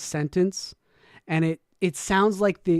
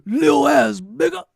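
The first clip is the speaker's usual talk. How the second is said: loud, much higher hype yell; chest voice shout; 7 semitones higher, 5 dB louder than usual. Pretend. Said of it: "Say it with your chest".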